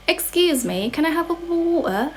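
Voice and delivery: high-pitched mock British with dropped T's; British accent. Shown as a demonstration. Asking for a drink as a Brit for the viewers, mock British accent. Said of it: "how to say in British accent".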